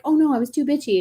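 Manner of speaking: high-pitched, fretful self-correction; acted-out worry at having overdone it; mock dismay. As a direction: play up a little "oh no" regret. Acting out overcorrecting on boundaries.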